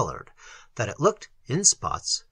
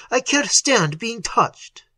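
higher, stronger anguished cry; sobbed outburst, acted; 8 semitones higher, 4 dB louder, slower than usual. A narrator's reading. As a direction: cry it out slowly, near sobbing. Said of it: "he sobbed".

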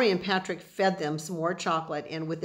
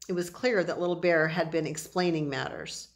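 ordinary delivery

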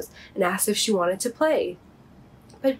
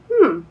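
thoughtful hmm; 7 semitones higher, 8 dB louder than usual. Acted. Bobby ponders aloud.

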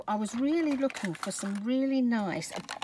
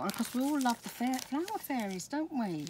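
usual speaking voice

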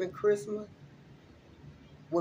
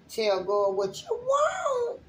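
emotional cry; slower than usual. Real, not acted. Spiritual reader urging viewers to pray, overcome with tears. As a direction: voice cracking high into a tearful cry, slow. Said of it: "I was a little emotional".

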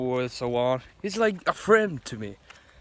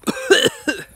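sharp high cough; choking cough; 6 dB louder than usual. Real, not acted. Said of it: "I think I'm choking".

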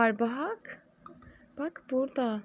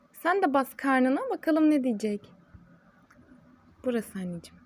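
ordinary talk

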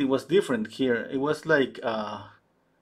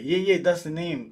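normal talking voice

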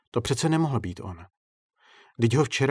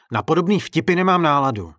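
loud, raised, annoyed protest; fed-up raised voice; irritated; 5 semitones higher, 8 dB louder than usual. A narrator's reading. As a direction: refuse the joke crossly. Director snaps at the prankster.

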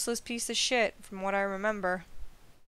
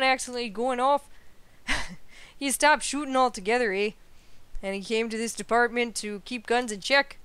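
put-on Canadian accent; 4 semitones higher, 4 dB louder than usual. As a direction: tell the Torres story in a Canadian lilt, leaning on each "eh". Voices Canadian officer's long speech.

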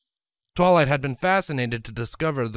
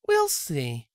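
higher smug mare voice; smirking; 4 semitones higher than usual. A narrator's reading. Scarlet smug to her husband, smirking. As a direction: let the smirk be heard. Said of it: "Scarlet smirked".

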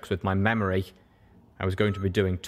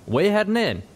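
high, louder call to a friend; Calamity calling out; 11 semitones higher, 4 dB louder than usual. Acted. Calamity calls out to Little Pip, a cheerful call. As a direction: sing out the name across the cabin. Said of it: "Calamity called out".

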